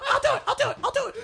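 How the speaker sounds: very high, squeaky, gleeful goblin; overjoyed goblin. Acted. Goblin thrilled to farm mushrooms instead. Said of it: "he's just like".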